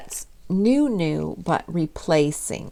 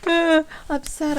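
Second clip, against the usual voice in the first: much higher, hammed-up dismay; mock distress. Telling the representative she's upset, hammed-up dismay. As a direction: whine it like a heartbroken fan. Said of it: "And I'm like".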